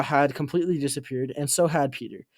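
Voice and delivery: usual speaking voice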